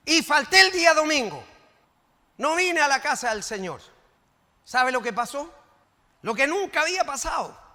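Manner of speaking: loud, high confessional preaching; preaching at full force. Acted. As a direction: drive the warning home loudly. Preacher confesses, building loudly.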